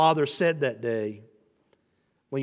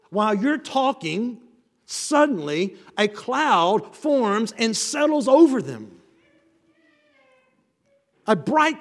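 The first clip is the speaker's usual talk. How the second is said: higher and louder, swelling with awe; fervent preaching climax; 6 semitones higher, 4 dB louder than usual. Real, not acted. Preacher to congregation describing the glory cloud, rising fervent awe. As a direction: lift the room as the cloud descends.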